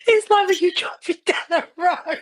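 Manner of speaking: high-pitched, struggling through giggles; real giggly squeak; helpless giggles. Real, not acted. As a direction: try to explain while losing it to laughter. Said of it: "Oh, we've lost her now".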